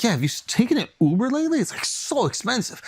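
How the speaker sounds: mock wife. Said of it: "She's like".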